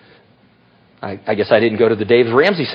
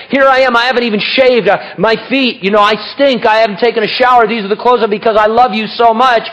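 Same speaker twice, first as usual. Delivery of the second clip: higher-pitched, eager self-justifying plea; earnest protest; 7 semitones higher than usual. Acted. Mephibosheth pleading his love to the king, earnest. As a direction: plead devotion in a raised voice.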